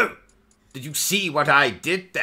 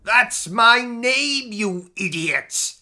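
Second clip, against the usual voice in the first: exasperated; 7 semitones higher, slower than usual. Acted. Cheese sandwich labelled Reuben.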